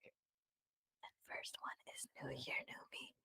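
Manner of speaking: soft unvoiced whisper, mouthing each word. Real, not acted. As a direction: breathe the words out with no voice. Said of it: "Being a little A S M R".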